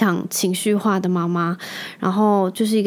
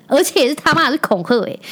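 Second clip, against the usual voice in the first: indignant swearing; 6 semitones higher than usual. Real, not acted. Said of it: "我就很生气".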